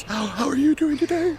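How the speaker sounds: high, gasped in on the breath through a laugh; inhaled speech, laughing. Shown as a demonstration. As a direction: try to talk while breathing in and crack up. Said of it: "It goes back and forth".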